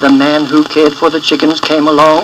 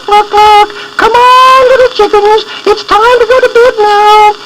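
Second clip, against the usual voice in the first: high, louder clucking call; hen calling; 4 dB louder than usual. A narrator's reading. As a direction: cluck to call the chicks home. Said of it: "she called".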